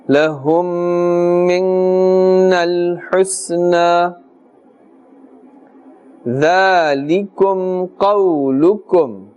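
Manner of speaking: slow, sustained tajweed chant on a level tone; Quran recitation. Shown as a demonstration. Teacher recites the verse for learners, slow sustained chant. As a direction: chant slowly, holding the nasal hum long.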